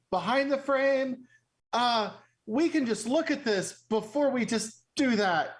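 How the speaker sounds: halting, flustered plea to hold off; mildly panicked. Acted. Player voices character trying to halt map cutting, mildly panicked. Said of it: "I know you said kind of panicked".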